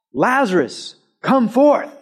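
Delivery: booming shout, high and loud; shouted command. Acted. Pastor acting Jesus calling into the tomb, shouting. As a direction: call him out of the tomb at full voice. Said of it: "Real loud".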